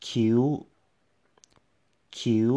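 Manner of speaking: คิ้ว on a high tone. Shown as a demonstration. Teacher modelling คิ้ว for learners, high tone. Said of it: "high tone".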